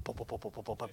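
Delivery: soft, low thumping heartbeat noise; imitated pounding heartbeat. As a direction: mimic a heart racing under the breath. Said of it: "beating really fast".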